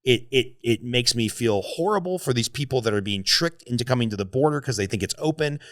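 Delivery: usual speaking voice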